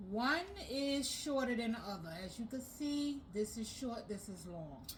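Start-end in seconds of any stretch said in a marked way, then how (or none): none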